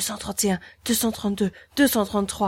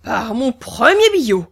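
executioner growling oath; 4 semitones higher, 7 dB louder than usual. Acted. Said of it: "grogna le bourreau".

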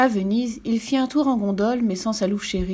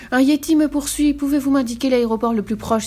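loud, raised, hurried-sounding request; urgent plea; 6 semitones higher, 4 dB louder than usual. A narrator's reading. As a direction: ask for help while being chased. Lou flees, urgently asks directions. (supplier avec urgence, voix montée)

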